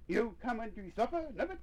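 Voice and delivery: high-pitched call to supper; singled-out name, lightly called. A narrator's reading. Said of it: "called Missus Nubbet".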